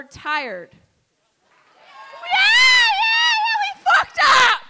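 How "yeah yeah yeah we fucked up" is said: yelled admission. Real, not acted. Comic shouting to the crowd that we fucked up. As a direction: belt it out to the room.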